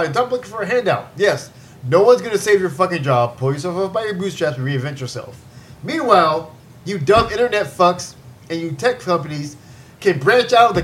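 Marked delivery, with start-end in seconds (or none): none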